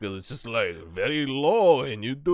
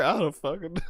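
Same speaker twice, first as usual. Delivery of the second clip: ordinary talk, nothing marked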